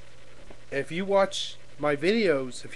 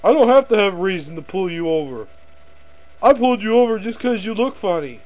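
loud, raised-pitch bullying rant; big prick cop; 5 semitones higher, 8 dB louder than usual. Acted. Cop who pulled someone over for looking funny, bullying shout. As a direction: bark it like an arrogant traffic cop. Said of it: "Look like one of those big prick cops".

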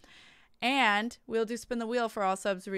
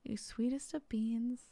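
soft affectionate whisper; 6 dB quieter than usual. Real, not acted. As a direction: breathe it tenderly to chat. Affectionate closing thanks to chat.

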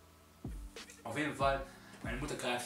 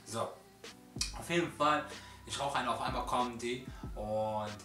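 ordinary delivery

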